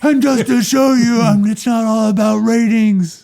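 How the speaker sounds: high, loud hammed-up actor impression; Waltz. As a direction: push the pitch way up in a mock performance. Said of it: "That's Waltz".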